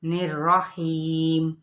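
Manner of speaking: slow chant, ending on a silent stop; slow tajweed chant; Quran recitation. Shown as a demonstration. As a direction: recite slowly and close on a held stop. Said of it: "वक्त बिल ISKCON".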